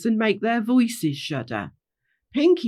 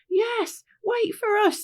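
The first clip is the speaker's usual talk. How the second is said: very high character voice; calling after someone; 13 semitones higher, slower than usual. Acted. Pinky Bow asking Rainbow to wait, high-pitched call. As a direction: pipe up, asking them to wait.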